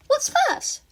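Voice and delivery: put-on curious high girlish voice; Himiko's voice. A narrator's reading. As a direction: pitch way up for the playful girl.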